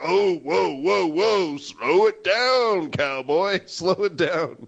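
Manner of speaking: slow, drawn-out whoa; hammed-up calming a cowboy; mock reining-in. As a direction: draw it out like halting a horse. Acted reply reining in the eager one.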